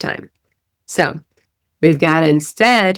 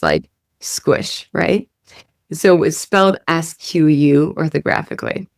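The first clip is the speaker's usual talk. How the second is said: ordinary talk, nothing marked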